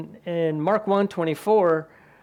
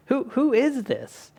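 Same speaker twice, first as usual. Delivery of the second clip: amazed question; 9 semitones higher than usual. Retold or imitated. Disciples amazed after storm.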